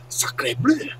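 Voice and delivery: hammed-up French accent with swooping pitch; terrible French accent. Caster asking about the replay, in a terrible French accent. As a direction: attempt a bad French accent. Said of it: "no, it's, it's terrible".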